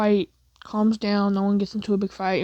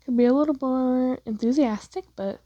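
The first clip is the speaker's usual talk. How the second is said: usual speaking voice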